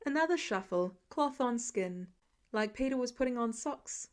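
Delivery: plain reading voice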